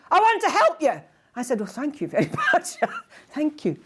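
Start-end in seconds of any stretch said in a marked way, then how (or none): 0.1-1.0 much higher and louder, an earnest plea; a big, high, imitated offer of help; imitating her eager offer. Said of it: "And that's how she said it". 1.6-3.8 high, thanks through a laugh; laughing, higher-pitched thank-you. Retold or imitated. Her own retold reply.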